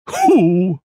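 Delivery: ordinary talk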